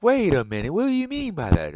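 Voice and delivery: high, loud, puzzled mock reaction; a hammed-up wait-what; confused double take. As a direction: play the baffled person who just got it. People confused by the comeback.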